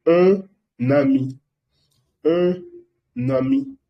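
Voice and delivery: un ami said very slowly with the liaison; slow demo of un ami for learners; slow model pronunciation. Shown as a demonstration. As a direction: drag out un ami, linking n to ami. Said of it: "eso se pronuncia".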